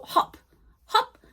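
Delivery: "hop" with a very short o; short vowel. Shown as a demonstration. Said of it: "it's a very short".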